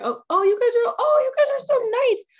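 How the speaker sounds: flustered by the praise, squeal it; touched and thrilled. Real, not acted. Streamer flattered by chat praise, high loud gushing.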